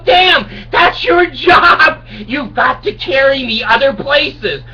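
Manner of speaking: high, slow, crying shout of a pleading man; desperate plea. Acted. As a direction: beg your own feet, voice cracking. Pleading with his feet.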